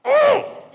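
tiny, very high "oh"; crushed squeak. Retold or imitated. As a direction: squeak in dismay at the refusal. Crushed reaction to refusal.

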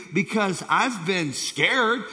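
usual speaking voice

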